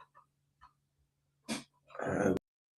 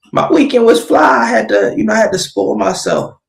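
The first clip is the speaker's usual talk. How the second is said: excited shout; 11 semitones higher, 8 dB louder than usual. Real, not acted. Excited shout about her weekend.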